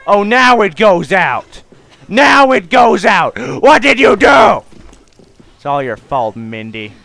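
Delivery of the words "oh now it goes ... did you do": slow, loud, high outburst; outraged yelling. Real, not acted. Player at fire going out too late, outraged shouting. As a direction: yell each word in exasperation.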